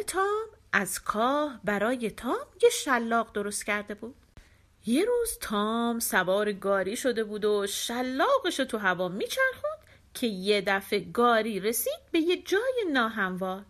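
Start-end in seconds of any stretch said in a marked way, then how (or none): none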